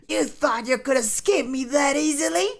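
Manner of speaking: high-pitched villain taunt with a cackle; hammed-up high mocking menace; Frieza. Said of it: "Frieza taunted".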